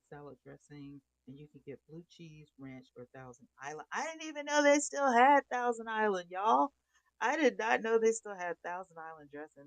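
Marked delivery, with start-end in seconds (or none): 3.9-9.6 loud, high burst of surprise; can't believe it's still on the menu; amazed outburst to viewers; shocked disbelief. Real, not acted. Shocked the dressing still exists.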